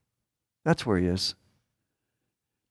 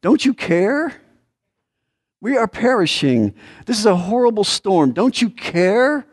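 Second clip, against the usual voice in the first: higher-pitched desperate plea, rising on the question; acted anguished appeal, pitched well up; pleading disciples; 7 semitones higher than usual. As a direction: cry out in distress to someone who should care. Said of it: "Mark says it this way".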